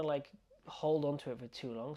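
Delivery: usual speaking voice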